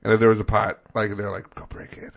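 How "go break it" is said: hushed whispered instruction; conspiratorial whisper. Acted. Someone urging a break-in for soup, conspiratorial whisper. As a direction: whisper it like a secret order.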